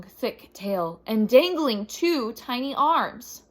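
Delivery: usual speaking voice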